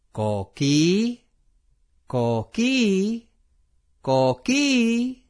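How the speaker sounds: coqui frog call. Shown as a demonstration. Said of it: "if we say coqui three times".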